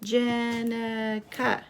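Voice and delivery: Jenica stretched slowly over three beats; slow, even Jenica for counting; syllable by syllable. Shown as a demonstration. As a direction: draw out each syllable of Jenica. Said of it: "Count the syllables".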